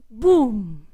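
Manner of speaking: low, loud mock explosion sound; explosion boom. Mocking a rocket blowing up with one loud boom.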